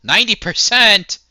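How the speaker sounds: loud, high burst of joy; excited shout. Real, not acted. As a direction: shout it out, thrilled at the score. Reacting to a high score.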